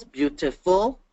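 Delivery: BEAUTIFUL in three beats, beau-ti-ful; teacher's segmented "beautiful"; syllable by syllable. Shown as a demonstration. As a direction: sound out "beautiful" with a lifted ending. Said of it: "Three syllables".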